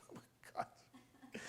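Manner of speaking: a soft breathy laugh under the breath. Real, not acted. Telling the class, laughing softly at himself. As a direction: laugh quietly at the memory.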